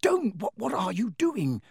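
high, alarmed hissed protest; urgent hiss. A narrator's reading. Harry stopping the elf hurting himself, hissing urgently. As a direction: stop him urgently, tripping over the question. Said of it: "Harry hissed".

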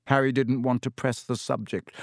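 ordinary delivery, nothing marked

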